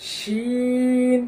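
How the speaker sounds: long sustained শিন, open sh sound; Arabic letter name. Shown as a demonstration. Teacher models শিন for students, slow recitation. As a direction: stretch শিন, teeth apart. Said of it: "কপালের চামড়া যেন বটে না যায়".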